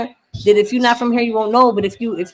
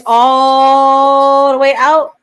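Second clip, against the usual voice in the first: "all" held very long and loud; loud, long-drawn "all" in "all the way out"; stretched-out ALL; 2 semitones higher, 8 dB louder, much slower than usual. Shown as a demonstration. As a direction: drag out "all" to show great distance. Shows how far streets stretch.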